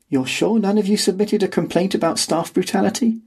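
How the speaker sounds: lightly voiced old scholar; a hint of a different man; character voice. A narrator's reading. Cantor voiced, different timbre.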